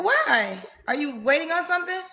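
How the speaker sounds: ordinary delivery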